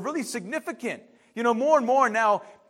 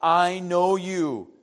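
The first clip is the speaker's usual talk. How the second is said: slowly, weighting "know" in "I know you"; "I know you" drawn out slowly; slow emphatic; 4 semitones lower, much slower than usual. Shown as a demonstration. Pastor stressing God's promise to the congregation, slowly. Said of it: "I created you".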